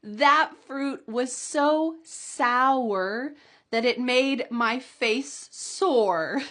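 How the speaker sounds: very slow, deliberate read; very slow model sentence. Shown as a demonstration. Teacher reading the sour fruit sentence very slowly. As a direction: drag every word out slowly. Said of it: "Can you say it with me".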